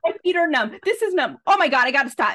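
reliving the scare, half laughing; retold panic. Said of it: "I was like".

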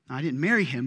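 ordinary talk

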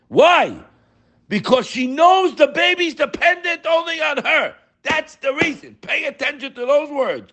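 high preaching emphasis. Real, not acted.

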